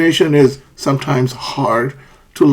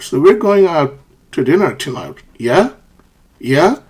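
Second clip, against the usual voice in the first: "Yeah" rising like a question; asking for confirmation. Shown as a demonstration. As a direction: tack on "Yeah" with an upward lift. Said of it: "you're asking for confirmation".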